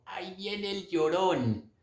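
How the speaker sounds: put-on high, soft, drawn-out old-lady voice; slow, hushed singling-out of a passer-by; gossiping old lady. Old lady nudging her friend about him, gossipy. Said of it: "una viejita le dio un codazo a la otra y le dijo".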